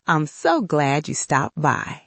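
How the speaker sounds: ordinary delivery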